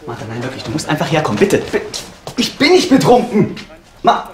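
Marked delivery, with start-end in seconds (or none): 2.4-4.3 loud, high, slow shout; desperate shout. Acted. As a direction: yell it in desperation. Yelling into phone at Martha.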